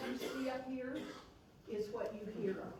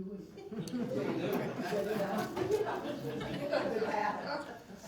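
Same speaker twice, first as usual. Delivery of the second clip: long laugh; 5 dB louder than usual. Real, not acted. Recalling cooing over baby.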